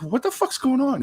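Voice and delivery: high-pitched acted bewilderment; baffled newcomer. New inmate asking the others, high and baffled. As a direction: ask it high, utterly puzzled.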